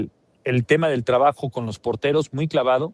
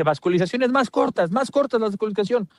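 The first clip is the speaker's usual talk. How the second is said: instrucción del entrenador; 6 semitones higher than usual. Retold or imitated. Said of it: "es lo que le dice".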